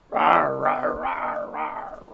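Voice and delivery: rawr rawr sinking deep and fading, playful beast; playful monster roars. Pretend. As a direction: growl like a friendly monster for the kids. Roaring along with monster story.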